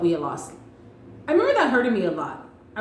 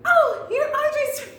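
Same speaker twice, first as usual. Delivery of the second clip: overly eager greeting. Retold or imitated. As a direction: gush at her like an overexcited puppy. Her own eager greeting, retold.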